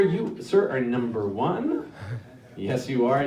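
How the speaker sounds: normal talking voice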